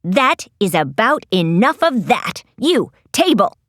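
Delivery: low, commanding Lizzie voice; mild angry shout. A narrator's reading. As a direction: order it around with mild force. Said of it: "she shouted at the furniture".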